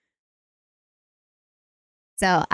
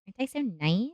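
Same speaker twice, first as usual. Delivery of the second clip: softer teasing; 10 dB quieter than usual. Real, not acted. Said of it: "repeated teasing".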